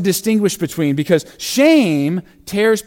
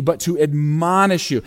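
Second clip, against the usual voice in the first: normal talking voice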